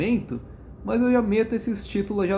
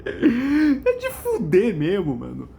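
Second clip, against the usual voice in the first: laughing; 5 semitones higher, much slower than usual. Real, not acted. Laughing at the joke.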